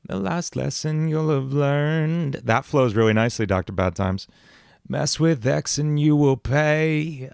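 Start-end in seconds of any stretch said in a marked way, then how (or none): 0.1-2.4 villain voice. Acted. Villain voice line for game. 4.9-7.3 villain voice. Acted. Villain voice line for game.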